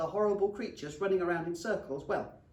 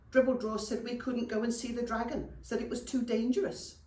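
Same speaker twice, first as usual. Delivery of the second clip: put-on higher, childlike goblin voice; little goblin Damp Patch; 5 semitones higher than usual. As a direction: complain like a small tell-tale goblin. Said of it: "said Damp Patch".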